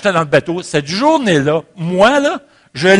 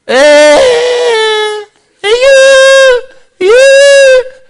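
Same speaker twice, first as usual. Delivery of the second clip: cri d'appel perçant, très aigu et très fort; crier au loin; 11 dB louder, much slower than usual. Retold or imitated. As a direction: hurler pour qu'on l'entende de l'autre côté de la rivière. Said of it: "je commence à crier". (cri perçant, très aigu et très fort)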